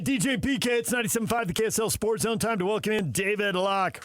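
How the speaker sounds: upbeat radio announcer read; bright on-air station call. Acted. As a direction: punch out the station ID like a jingle. Host reads station ID, intro.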